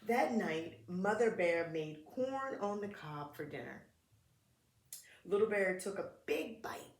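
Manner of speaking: put-on gentle read-aloud for kids; children's story narration; storybook reading. A narrator's reading. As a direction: read it like a picture book at story time.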